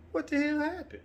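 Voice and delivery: high, loud startled question; shocked question. Retold or imitated. Son helping his fallen dad up, high shocked question. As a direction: ask in disbelief.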